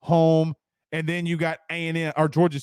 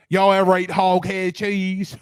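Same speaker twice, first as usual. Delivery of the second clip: imitating Chris; 3 dB louder than usual. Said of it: "Chris will come in".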